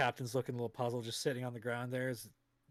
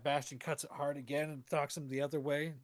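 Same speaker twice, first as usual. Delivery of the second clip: ordinary talk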